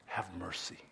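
very soft echo of the words; quiet echo; soft repeat. Acted. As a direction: say it again, barely there. Repeats the phrase softly.

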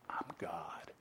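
hushed conspiratorial whisper; sly whisper. Acted. Preacher voicing an impostor's secret boast, whispered. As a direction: whisper it like a con man's secret. Impostor reveals false claim.

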